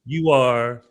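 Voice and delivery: ordinary talk, nothing marked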